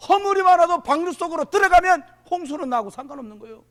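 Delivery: loud, high, driving sermon delivery; full-force preaching. Real, not acted. Preacher urging the congregation into the city of refuge, loud preaching. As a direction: press each promise home at full voice.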